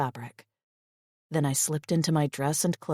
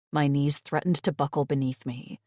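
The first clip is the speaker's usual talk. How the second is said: ordinary delivery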